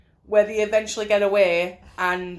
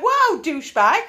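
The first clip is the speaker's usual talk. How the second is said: mock indignant girlfriend; 8 semitones higher than usual. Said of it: "she'd be like".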